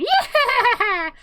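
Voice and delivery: high, loud whoop with a laugh; gleeful cackling yell. Retold or imitated. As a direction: cackle it out in triumph. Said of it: "he cackles and goes".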